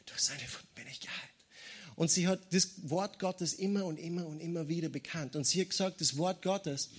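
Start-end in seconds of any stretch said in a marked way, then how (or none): 0.1-1.3 very soft, breath-only whisper; whispering back. Retold or imitated. Sick woman answering the screaming voice, whispered back. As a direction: imitate a frail woman's whispered reply. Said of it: "Sie hat einfach nur zurückgeflüstert".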